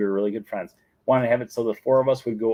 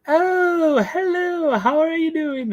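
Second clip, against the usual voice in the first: very high, trilling nanny greeting; Mary Poppins; 5 dB louder, much slower than usual. Retold or imitated. Storybook nanny arriving at the table, high put-on voice. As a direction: sing out a hammed-up, prim hello. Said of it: "Mary Poppins comes over and she's like".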